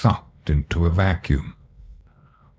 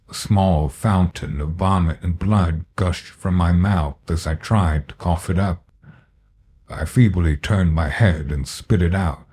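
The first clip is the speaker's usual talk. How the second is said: plain reading voice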